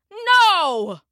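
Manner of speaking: high, exasperated mild shout; flustered shout. A narrator's reading. Engineer to confused dispatcher on phone, flustered shout. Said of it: "Fuller shouted, flustered now".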